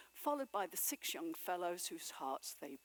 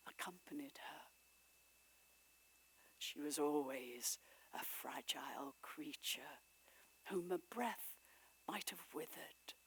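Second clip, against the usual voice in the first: hushed whisper; 4 semitones lower, 6 dB quieter than usual. A narrator's reading. Narrator hushes over fragile Fan, whispering. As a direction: whisper it, almost unvoiced.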